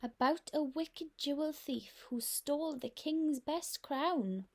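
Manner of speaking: usual speaking voice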